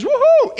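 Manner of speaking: high-pitched "woohoo"; playful high cheer; joyful whoop. Acted. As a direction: whoop for joy, high and sudden. Acts out Mary's rejoicing.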